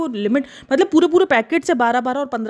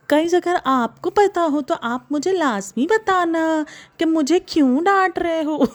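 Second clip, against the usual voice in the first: high, very slow, hammed-up wail; mock whiny plea; 6 semitones higher, slower than usual. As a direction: drag out the words like a fake-tearful complaint. Mock plea echoing the whiny imitation. (नकली रोनी, खिंची हुई फ़रियाद)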